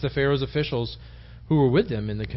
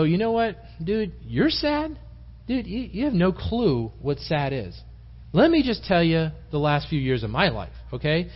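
higher-pitched, drawn-out one-upping put-down; mock indignant; 4 semitones higher than usual. Preacher imagines Joseph one-upping sad cellmates, mock indignant. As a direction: stretch the words out in mock disbelief.

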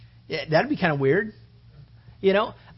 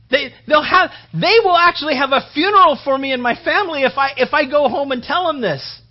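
raised, louder, pleading voice as if near tears; upset pleading; 9 semitones higher, 8 dB louder than usual. Retold or imitated. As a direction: retell a man's tearful, desperate plea, pitched up and loud. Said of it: "And he starts crying".

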